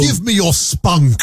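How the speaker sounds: slow mock Cockney demand; awful Cockney. Co-host topping the accent joke, hammed-up Cockney. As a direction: stretch it out in bad Cockney. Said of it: "You got the awful Cockney".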